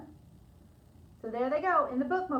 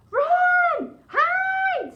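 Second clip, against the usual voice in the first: high, loud cry to flee; panicked shout; 12 dB louder than usual. Acted. Amelia Bedelia shouting at Lisa to flee, panicked shout. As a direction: yell it out in sudden panic. Fleeing an imagined dinosaur.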